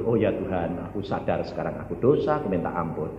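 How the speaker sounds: low, softened confession; acted contrite prayer. Preacher models asking God's forgiveness, low and contrite. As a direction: murmur it like a penitent praying. Acts out penitent confessing to God.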